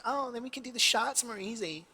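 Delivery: high, bright-voiced put-on American girl; imitating an American girl's accent; mock American accent. Quoting the flatmate girls in a put-on American accent. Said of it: "I don't know why I did that accent".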